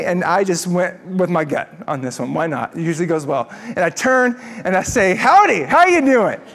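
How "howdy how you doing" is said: loud, high Texan drawl of a cheery howdy; booming Texas howdy to a cop; Texan greeting. Retold or imitated. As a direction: greet him like a good old Texan. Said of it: "like a good Texan".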